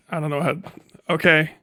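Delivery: ordinary delivery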